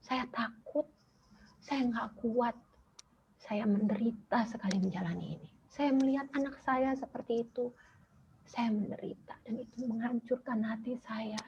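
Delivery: mother retelling her prayer to God, high and tearful. Retold or imitated. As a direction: pray it with a breaking voice. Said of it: "saya kasih taunya".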